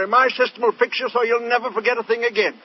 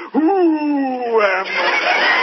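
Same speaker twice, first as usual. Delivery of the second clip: comic imitation of the patient calling out; dazed amnesia patient; 3 semitones higher, much slower than usual. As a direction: wail it out slowly, utterly lost. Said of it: "Now she says".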